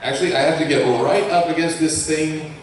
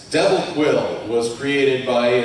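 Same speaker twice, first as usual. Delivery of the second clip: normal talking voice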